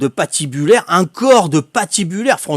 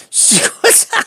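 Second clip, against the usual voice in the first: can't believe what you see, laugh it; high laughing disbelief; slower than usual. Real, not acted. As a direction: squeal it out, high and breathless through the laugh.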